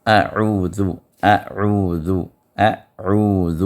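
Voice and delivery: اعوذ drawn out, throat letters clear; articulating a'udhu. Shown as a demonstration. Teacher drilling students on اعوذ, careful articulation. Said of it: "اعوذ باللہ پہ تھوڑی سی مشق".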